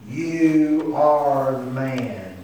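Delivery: very slow, drawn-out words; solemn accusation. Acted. Nathan confronting David, slow solemn accusation. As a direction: weigh every word of the charge.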